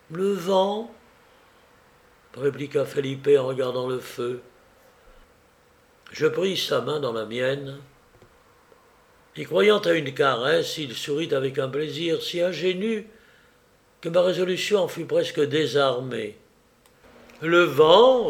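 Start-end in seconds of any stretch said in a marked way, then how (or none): 0.1-0.9 high, rising Felipe reply; evasive answer. A narrator's reading. Felipe dodges the guest's question about cries, evasively. 17.4-18.3 loud, falling, challenging echo; incredulous echo. A narrator's reading. Guest challenges Felipe's excuse for the cries, incredulously. Narrator repeats Felipe's answer in disbelief.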